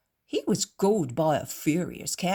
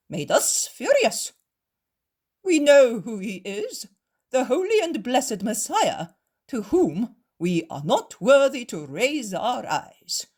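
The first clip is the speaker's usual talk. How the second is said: loud, wheedling, slower in places; fawning; 5 semitones higher, 6 dB louder than usual. Acted. Cowed Pharisees beg the innkeeper, fawning. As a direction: grovel like creeping pups. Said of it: "the two Pharisees change tone".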